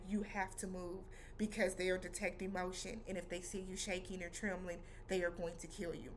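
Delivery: low, soft, measured caution; quiet, deliberate urging; hushed warning. Retold or imitated. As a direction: warn a friend under danger, keeping it down. Friend urgently warns the frozen man.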